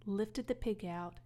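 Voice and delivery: usual speaking voice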